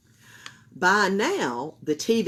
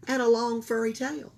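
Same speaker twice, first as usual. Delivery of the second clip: ordinary delivery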